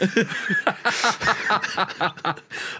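high laughing fit; real laughter. Real, not acted. Laughing at the strong drink.

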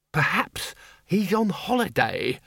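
lightly voiced high, slow bursar; dithery bursar. A narrator's reading.

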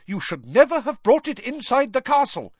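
high, loud furious yell. A narrator's reading. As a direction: yell it at the minister in outrage. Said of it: "yelled Professor McGonagall".

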